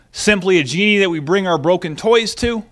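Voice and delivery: normal talking voice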